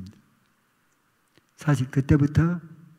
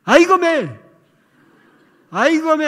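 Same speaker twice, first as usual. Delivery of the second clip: high, loud yelp of pain; 12 semitones higher, 7 dB louder than usual. Retold or imitated. Boy yelping at the teacher's cane, high and loud. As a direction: cry out under the cane like a hurt boy. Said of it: "나도 모르게 엄살이 나온 거예요".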